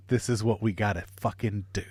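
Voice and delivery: hushed grim whisper. Retold or imitated. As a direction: whisper it, resigned and determined. Grim resolve, sneaking monkey.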